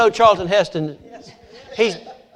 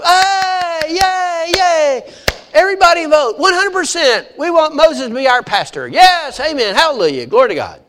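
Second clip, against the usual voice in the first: cheering crowd; 7 semitones higher than usual. Acted. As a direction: whoop it up like a crowd voting yes. Israelites cheer their new leader.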